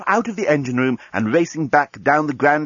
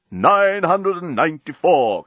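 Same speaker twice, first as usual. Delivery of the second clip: bomb counting down; 6 semitones higher, slower than usual. Acted.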